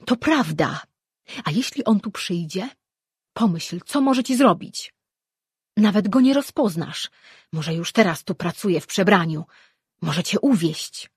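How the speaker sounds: loud, insistent urging; alarmed warning. A narrator's reading. Mother warns daughter about the returned dreamweaver, alarmed and loud. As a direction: press each warning home loudly.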